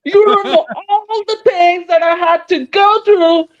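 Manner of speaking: put-on high, slow, whiny Valley Girl accent; hammed-up Valley Girl accent with a fake sob. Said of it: "a Valley Girl accent".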